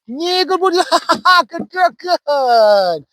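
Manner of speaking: very high-pitched, slow, sing-song praise with a laugh. Shown as a demonstration. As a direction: stretch the praise way up high like a fiddle's top note. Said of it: "from the very high pitched".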